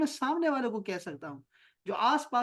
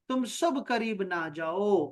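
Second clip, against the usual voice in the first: ordinary talk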